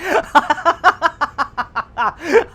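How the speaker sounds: high burst of long, real laughter. Real, not acted. Streamer reacting to a viewer, long helpless laugh. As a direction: crack up helplessly.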